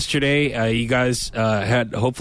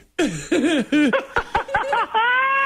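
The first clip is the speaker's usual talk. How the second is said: shouting laugh, high. Real, not acted. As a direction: howl with laughter. Loud laugh at reveal.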